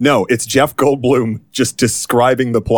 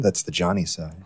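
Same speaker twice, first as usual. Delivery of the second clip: quiet; 4 semitones lower, 8 dB quieter than usual. Shown as a demonstration. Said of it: "here how it comes up".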